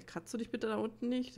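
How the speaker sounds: ordinary talk, nothing marked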